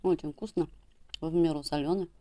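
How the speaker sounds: between sips of a drink; speaking into a mug. Real, not acted. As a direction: cup against the lips. Seen 0: No fish seen being chewed; mug held at her lips while talking.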